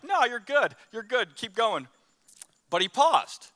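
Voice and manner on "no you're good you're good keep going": high, easy mock reassurance from a friend; breezy reassurance. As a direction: brush it off lightly like a buddy. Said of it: "Fully expecting him to be like".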